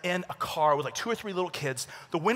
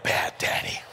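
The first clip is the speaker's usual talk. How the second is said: judging onlookers; slower than usual. Retold or imitated. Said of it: "people looking at me like".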